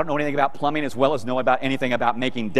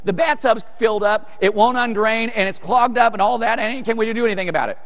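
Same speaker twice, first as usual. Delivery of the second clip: lightly voiced wife, higher pitch; wife calling out; 8 semitones higher than usual. Wife reporting the clogged tub to husband, lightly mimicked higher call. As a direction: imitate a wife's raised-pitch complaint. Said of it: "she yelled out".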